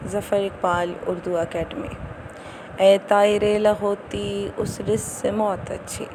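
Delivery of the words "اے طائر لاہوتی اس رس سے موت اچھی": declaimed verse line, stretched out; poetry recitation. Acted. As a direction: recite it like a couplet. (شعر پڑھنے کا انداز)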